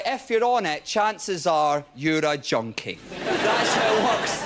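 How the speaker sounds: normal talking voice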